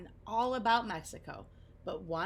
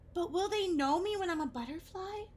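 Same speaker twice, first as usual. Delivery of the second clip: put-on a higher, anxious young voice; little caterpillar asking his friend, lightly worried; 10 semitones higher, 3 dB louder than usual. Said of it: "Oscar asked".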